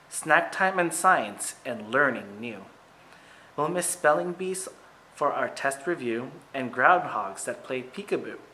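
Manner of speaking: ordinary delivery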